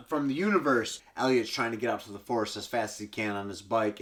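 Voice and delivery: ordinary talk, nothing marked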